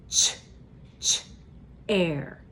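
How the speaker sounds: "Ch, ch, air" said as split sounds, ch repeated then air; segmenting onset and rime. Shown as a demonstration. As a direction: stretch "Ch" and then "air" apart, slowly. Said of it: "talk about that first sound in chair".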